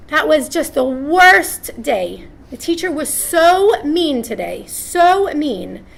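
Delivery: a hint of a sulky kid; whiny child. Child complains about the teacher.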